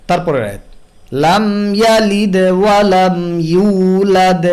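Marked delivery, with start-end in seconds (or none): 1.2-4.5 slow, drawn-out chanted recitation; Quran recitation. Shown as a demonstration. Tajweed teacher reciting the verse as a model: slow Quran recitation. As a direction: recite it slowly and melodically.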